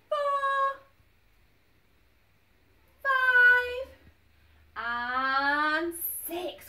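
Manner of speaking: put-on loud, high counting for kids; teaching a child to count. Shown as a demonstration. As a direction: call out each number to the class. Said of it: "Count with me".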